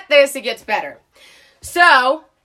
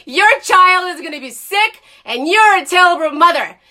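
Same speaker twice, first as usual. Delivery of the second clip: higher, louder scolding; angry accusation; 5 semitones higher, 6 dB louder than usual. Retold or imitated. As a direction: shout it at her in outrage. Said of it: "And you know what I said?".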